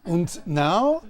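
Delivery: put-on slow, loud, commanding German accent. Warm-up leader addressing the group, loud German accent. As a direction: order them in a booming German accent. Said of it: "I'm gonna do German".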